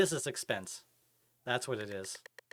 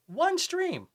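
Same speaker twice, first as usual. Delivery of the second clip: "one stream" pitched way up in disbelief; incredulous emphasis; 14 semitones higher, 4 dB louder than usual. Real, not acted. Streamer scolding a featured viewer who cursed, "one stream" stressed high. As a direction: hit "one stream" high, as an indignant repeat.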